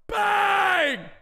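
stretched excited announcement; hype shout. Real, not acted. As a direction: slam the name out like a goal call. Pack reveal shout.

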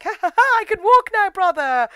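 high, loud villainous cackle voice; evil cackling. Retold or imitated. Hooded brother taunting Joseph in a dream, evil cackling. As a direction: crow it in a high-pitched mock-evil laugh. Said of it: "Evil crackling".

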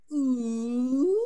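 a grunty, moany whale call; a high moan like a whale. Shown as a demonstration. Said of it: "I'll give you a little language lesson".

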